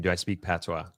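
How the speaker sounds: ordinary talk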